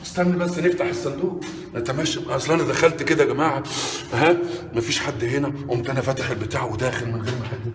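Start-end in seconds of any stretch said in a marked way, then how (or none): none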